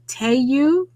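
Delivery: "Teo" said as a country "TAY-you"; Southern mispronunciation. Shown as a demonstration. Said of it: "but I say it wrong".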